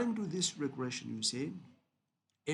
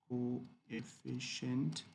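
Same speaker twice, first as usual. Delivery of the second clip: coefficient very slowly, syllable by syllable; slow sounding-out; 3 dB quieter, much slower than usual. Shown as a demonstration. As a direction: stretch out coefficient as co-e-ffi-cient. Teacher sounds out term slowly.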